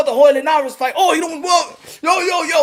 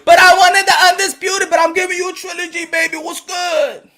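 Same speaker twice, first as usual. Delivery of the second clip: loud, higher, half-crying complaint; mock whiny yell; 6 semitones higher, 4 dB louder than usual. A fan whining at boxing like a let-down lover, mock yell. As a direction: whine it out like a jilted partner.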